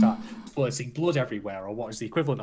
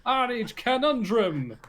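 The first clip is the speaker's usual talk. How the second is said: wrestling commentator; 9 semitones higher, slower than usual. Pretend. Wrestling show opening announcement.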